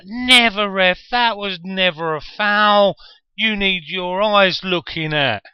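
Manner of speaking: very high, loud, drawn-out yell at the ref; imitated outraged shout. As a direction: bellow like an incensed football aunt, stretching the words. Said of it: "she'd shout".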